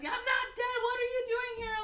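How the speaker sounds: high, loud indignant squawk; acted shouting back. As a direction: yell back at your double in shock. Screaming match with his clone.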